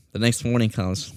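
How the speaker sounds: ordinary talk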